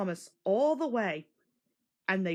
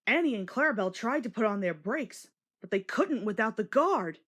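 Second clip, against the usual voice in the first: ordinary delivery, nothing marked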